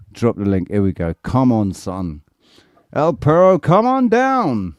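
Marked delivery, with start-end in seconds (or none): 2.9-4.7 high, drawn-out game-show summons; hammed-up announcer, pitched way up; mock announcer call. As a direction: call the contestant down with a big stretched finish. Calling up a guest like announcer.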